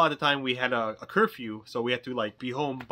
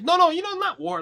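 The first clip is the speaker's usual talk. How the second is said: high, loud, flustered denial; frantic correction; 7 dB louder than usual. Retold or imitated. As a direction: backpedal fast in a raised, high voice. Frantically correcting the agent's misunderstanding.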